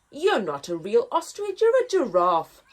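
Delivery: loud squawking ostrich retort; noisy ostrich squawk. Acted. Ostrich rejecting the giraffe, squawking loudly. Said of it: "squawked a noisy ostrich".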